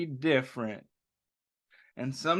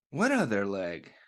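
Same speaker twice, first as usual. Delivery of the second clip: lower, dry joking reply; deadpan Joe; 8 semitones lower than usual. A narrator's reading. Reading to children, Joe jokes back at the kids. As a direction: play it dry and low.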